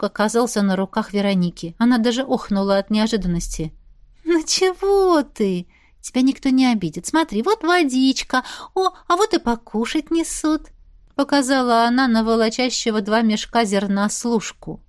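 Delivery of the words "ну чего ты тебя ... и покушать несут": high, cooing reassurance; gentle girlish coaxing, pitched up; soothing pet talk. A narrator's reading. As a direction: coo to a frightened little animal. Girl soothes the frightened squirrel.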